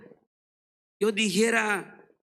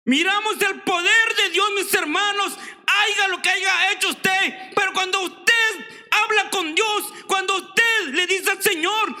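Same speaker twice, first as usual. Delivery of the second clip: much higher, louder full-force sermon; shouting preacher proclaiming God's power; fiery preaching; 11 semitones higher, 5 dB louder than usual. Acted. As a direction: preach it to the back row.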